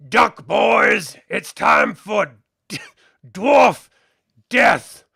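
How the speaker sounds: loud, rising orc war cry; gleeful orc shout. Acted. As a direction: yell it, relishing the kill. Orc archer warns his mates.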